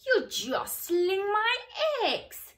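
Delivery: high, loud squawking hen voice. A narrator's reading. Hen squawking at the cow jostling her eggs, loud and high. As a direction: squawk it out, indignant and shrill. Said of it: "squawked the hen".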